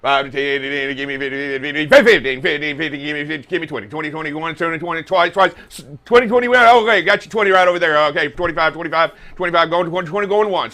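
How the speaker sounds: rapid-fire auctioneer chant, rising. Pretend. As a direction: rattle off the bids like an auctioneer. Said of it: "Let's do it".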